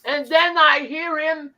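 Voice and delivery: ordinary delivery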